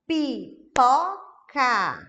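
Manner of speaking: "pipoca" very slow and loud, pi, po, ca, each apart; syllable by syllable. Shown as a demonstration. Example of clear gestures per syllable, "pipoca" in separated syllables. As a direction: punch out "pipoca" with a gap after each syllable. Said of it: "ela é formada por aqueles pedacinhos".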